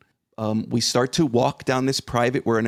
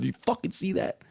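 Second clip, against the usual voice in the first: high, softer incredulous question; retold hushed astonishment; 8 semitones higher, 6 dB quieter, faster than usual. Father re-enacts his first words to his son, hushed excitement. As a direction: repeat the outburst high but softly.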